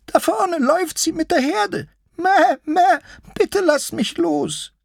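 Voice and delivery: very high, bleating little sheep voice; high-pitched timid lamb, begging; frightened sheep-lion. Acted. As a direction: plead in a tiny high voice, bleating loudly.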